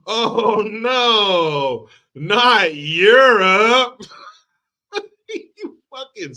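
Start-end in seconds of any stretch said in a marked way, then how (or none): 2.1-4.0 "Europe" stretched slow in a fake terrified wail; drawn-out whimpering "not Europe"; mock fear. Mock fearful take of Europe.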